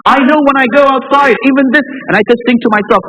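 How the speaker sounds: high, loud, impassioned preaching; fiery preaching. Acted. Preacher rousing the congregation about unpunished tyrants, at full force. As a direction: drive it home from the pulpit.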